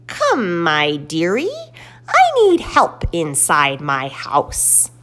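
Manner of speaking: slow wicked witch invitation. Acted. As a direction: croon it like a witch luring a child. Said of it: "Then she cackles".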